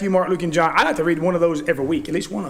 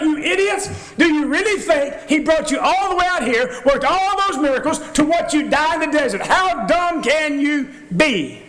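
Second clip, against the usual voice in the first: screaming at them; 6 semitones higher than usual. Retold or imitated. Preacher imagines yelling at the griping Israelites, angry shout. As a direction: blow up at them like a fed-up leader. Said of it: "I'd be screaming at these guys".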